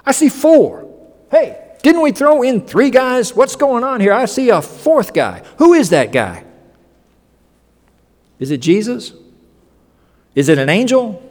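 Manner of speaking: high, amazed counting and asking; astonished king. Acted. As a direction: gape at the fourth man. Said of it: "he says".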